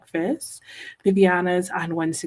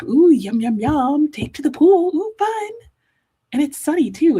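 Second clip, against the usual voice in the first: higher and higher, bubbly cheer; squealing along with a friend's plans; gushing excitement; 8 semitones higher, 5 dB louder than usual. Real, not acted. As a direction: get more thrilled with each word. Excited about a friend's pool dip.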